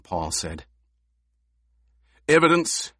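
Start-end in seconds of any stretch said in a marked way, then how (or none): 2.3-2.9 one word, higher and louder; insistent. A narrator's reading. Gurney demanding proof from Paul, insistent. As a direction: demand it flatly. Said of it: "Gurney insisted".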